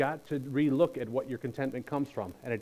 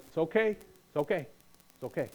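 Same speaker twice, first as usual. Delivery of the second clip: soothing, fading softer each time; reassuring, trailing off quieter; calming reassurance; 6 dB quieter than usual. Retold or imitated. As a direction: settle a panicked caller gently. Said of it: "We're gonna let this person talk".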